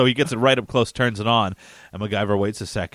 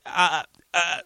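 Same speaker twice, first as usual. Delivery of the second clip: higher-pitched clueless "ah"s; flustered stammer; 5 semitones higher than usual. Retold or imitated. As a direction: imitate his helpless reaction. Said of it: "his reaction was great".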